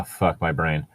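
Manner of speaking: normal talking voice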